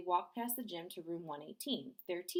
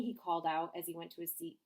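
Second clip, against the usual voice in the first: usual speaking voice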